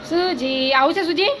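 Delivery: calling out. Real, not acted. Calling to Suji loudly.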